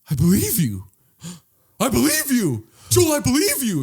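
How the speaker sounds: high, rising eager reassurance; eager believer. Retold or imitated. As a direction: gasp and believe him harder each time. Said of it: "I'd be like".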